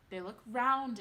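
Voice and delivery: loud, firm stress on round; insisting. A narrator's reading. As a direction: hammer the word round home. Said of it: "insisted Mister Wonka".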